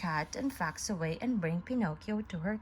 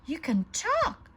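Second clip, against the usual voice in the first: high, louder cry of astonishment; amazed exclaim; 8 semitones higher, 4 dB louder than usual. A narrator's reading. Woodcarver amazed his puppet speaks, surprised cry. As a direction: gasp it out in wonder. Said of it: "exclaimed Geppetto".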